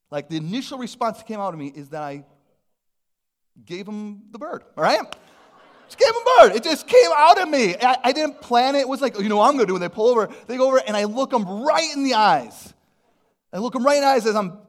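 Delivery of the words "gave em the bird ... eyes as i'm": loud, high, fast storytelling burst; high and loud as he relives the road rage; excited. Real, not acted. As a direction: build it up big and loud, like it just happened. Excited retelling of flipping the bird.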